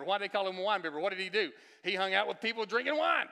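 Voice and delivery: high, loud, building sermon voice; fiery preaching. Acted. Pastor preaching to the congregation, fiery and climbing. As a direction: preach it hard and rising to the crowd.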